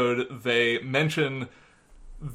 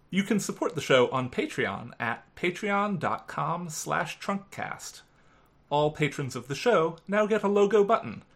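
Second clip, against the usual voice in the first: soft, steady outro read; podcast outro read; 5 dB quieter than usual. Acted. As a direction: read the credits calmly.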